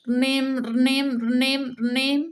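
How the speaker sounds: high, drawn-out ringing noise; sustained sing-out ring, held on each pulse; imitated phone ringing. A narrator's reading. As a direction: mimic a string telephone's ring. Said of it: "നൂൽ phone അടിച്ചു".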